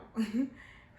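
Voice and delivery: short happy laugh; light laugh. Real, not acted. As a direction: give a quick delighted laugh. Laughing about baby kicking.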